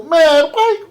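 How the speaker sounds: high, loud mock despair; pained complaint at bad news; exasperated whine. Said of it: "I go".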